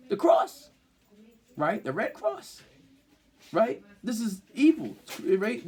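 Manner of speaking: high, loud, passionate preaching against the "cross"; fiery preaching. Acted. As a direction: rail against the symbol like a pulpit preacher.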